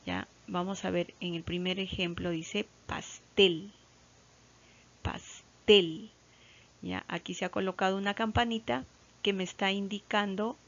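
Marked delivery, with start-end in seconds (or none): 2.9-6.1 pastel with TEL held and stressed; stress on last syllable. Shown as a demonstration. Teacher showing children the stressed syllable, TEL stressed in pastel. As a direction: punch the second syllable of pastel. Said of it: "destacando la mayor fuerza de voz".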